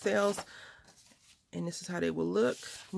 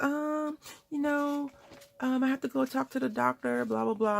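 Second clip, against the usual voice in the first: nurse voice; 7 semitones higher than usual. Retold or imitated.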